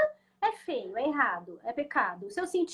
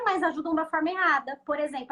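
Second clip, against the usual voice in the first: ordinary talk, nothing marked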